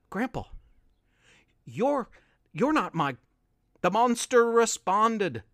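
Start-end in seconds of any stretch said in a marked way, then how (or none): 0.1-3.2 quivering. A narrator's reading. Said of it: "a quivering".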